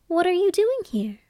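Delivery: high, curious princess question; cartoon princess. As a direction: ask it like a wide-eyed young princess. Princess questions old lady.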